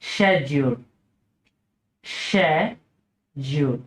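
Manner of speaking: British SHED-yool for schedule; British pronunciation. Shown as a demonstration. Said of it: "British উচ্চারণ হচ্ছে".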